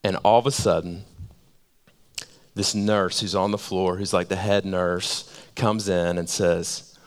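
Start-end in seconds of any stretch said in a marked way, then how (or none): none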